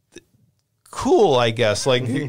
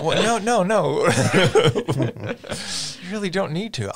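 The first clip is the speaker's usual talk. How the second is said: laughing objection; 6 semitones higher than usual. Pretend. Disney exec arguing with Cage.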